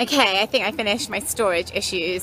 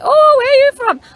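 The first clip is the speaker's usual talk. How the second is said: put-on high, loud stab at a thick Scottish accent, quickly abandoned; attempted Scottish accent; 8 dB louder than usual. Celtic supporter asking the vlogger where she's from, mock Scottish accent. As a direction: mimic a Scottish football lad's accent, badly. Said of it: "Can't do the accent".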